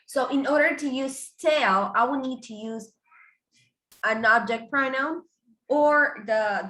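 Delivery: ordinary talk, nothing marked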